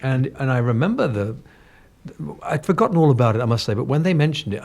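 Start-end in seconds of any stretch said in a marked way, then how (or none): none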